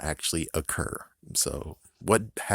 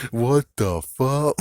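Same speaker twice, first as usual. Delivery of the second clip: incredulous; 6 semitones higher, 7 dB louder, much slower than usual. Real, not acted. Incredulous at the plot.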